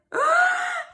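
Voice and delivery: loud high gasping inhale; shout-gasp before a sneeze; big sneeze gasp. Acted. Gerald gasping hard as a sneeze builds.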